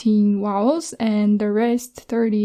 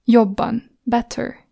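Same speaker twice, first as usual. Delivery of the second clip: usual speaking voice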